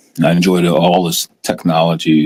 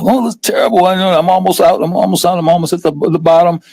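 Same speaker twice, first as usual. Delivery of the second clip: raised pitch, fretting over losses; panicky small investor; 10 semitones higher than usual. Retold or imitated. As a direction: play a worried investor near the bottom. Voicing a nervous small investor.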